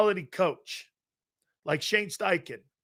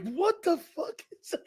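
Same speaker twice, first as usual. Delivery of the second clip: retold disbelief; 11 semitones higher, 5 dB quieter than usual. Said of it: "I was like".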